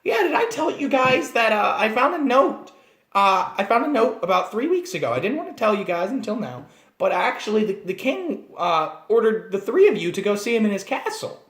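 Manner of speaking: high, chirpy little gnome confessing; annoying gnome voice. Shown as a demonstration. Gnome player confessing a hidden note to the party, squeaky. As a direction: keep the squeaky voice going, oblivious. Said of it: "hearing me talk like this".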